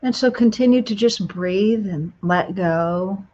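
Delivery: guided meditation. Acted. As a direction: ease them into calm, unhurried.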